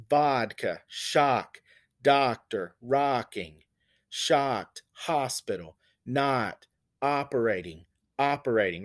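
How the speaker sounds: vodka, shock, doctor spoken one by one leaning on the ah; a slow list hitting the ah vowel; stressing ah sound. Shown as a demonstration. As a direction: pick out each ah word separately. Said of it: "do you hear that ah sound".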